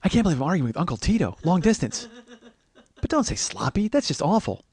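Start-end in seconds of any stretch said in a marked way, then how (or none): none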